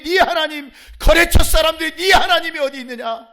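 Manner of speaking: high, very loud challenge; shouted, accusing jeer; mock taunting shout. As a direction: imitate scoffers hurling a question at a believer. Acts out relatives, clients taunting.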